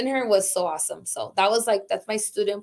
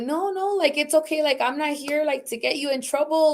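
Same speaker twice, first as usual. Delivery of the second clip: higher-pitched, placating retold reassurance; reassuring; 5 semitones higher than usual. Department chair telling teachers they are safe, reassuring. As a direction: soothe someone worried they are in trouble.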